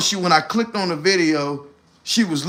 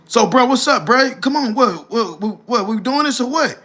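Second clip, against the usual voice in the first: impatient friend; 6 semitones higher than usual. Retold or imitated. Friend pressing on phone.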